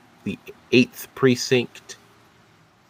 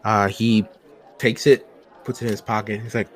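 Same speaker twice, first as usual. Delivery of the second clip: ordinary delivery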